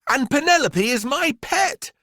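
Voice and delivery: put-on high, loud little-girl voice; Miranda. A narrator's reading. Miranda defending her python to the headmistress, high and firm. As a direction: stand up for her pet. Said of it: "said Miranda".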